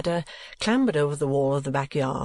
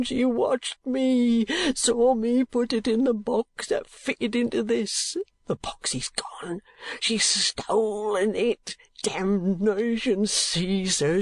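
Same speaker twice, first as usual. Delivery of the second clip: high, very slow broken lament with whispers; raving old miser; 6 semitones higher, much slower than usual. Acted. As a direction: fall apart in despair. Gride raving.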